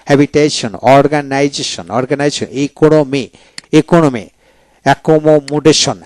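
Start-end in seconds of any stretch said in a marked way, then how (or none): none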